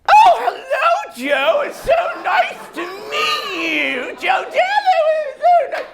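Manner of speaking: high, slow, shouted Doubtfire voice; Missus Doubtfire mom. Retold or imitated. Girlfriend's mom greets the nervous boyfriend, Doubtfire-style. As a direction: sing it out like a sugary granny. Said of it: "she sounds like Missus Doubtfire".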